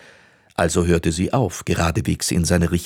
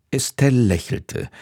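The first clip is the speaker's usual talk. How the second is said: ordinary delivery, nothing marked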